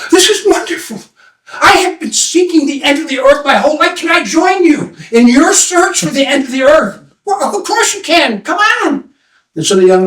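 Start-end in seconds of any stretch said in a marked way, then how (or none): 0.1-7.0 high, thrilled request; delighted young man. Acted. Young man eagerly asking to join the line, high and thrilled. 7.2-9.1 high, cheery welcome; welcoming follower. Acted. Follower welcoming the young man into line, high and cheery.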